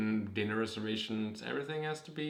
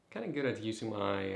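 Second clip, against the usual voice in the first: ordinary delivery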